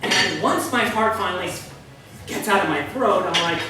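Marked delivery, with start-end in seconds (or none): none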